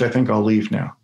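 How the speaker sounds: ordinary talk, nothing marked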